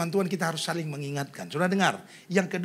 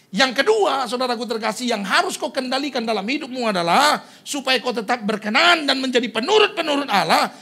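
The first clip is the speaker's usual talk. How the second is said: raised, loud pulpit voice; preacher pressing the main point; forceful preaching; 7 semitones higher, 8 dB louder than usual. Real, not acted. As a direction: drive the point home loudly.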